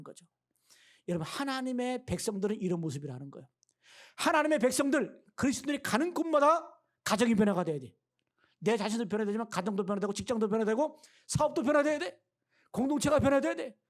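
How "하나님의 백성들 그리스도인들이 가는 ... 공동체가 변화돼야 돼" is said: louder and higher, pressing each phrase; fiery preaching. Real, not acted. Pastor urging believers to change every home, loud and fervent.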